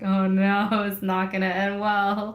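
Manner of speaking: loud, comic oh-no on the drive home; mock dread. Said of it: "it's always scary when".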